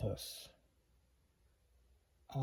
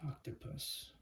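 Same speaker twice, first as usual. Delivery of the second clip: Octopus whispered, much softer; 2 semitones higher, 12 dB quieter than usual. Shown as a demonstration. Teacher whispering Octopus to the class, hushed.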